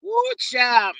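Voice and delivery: ordinary delivery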